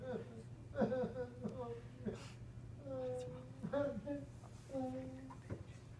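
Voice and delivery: high, wailing fake cry; long hammed-up boo-hoo; mock dragon sobbing. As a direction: wail like a heartbroken dragon. Dragon sobbing in read story.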